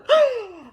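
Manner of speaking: high, loud dramatic gasp; mock shocked gasp. Acts out Miss Wanda's shock.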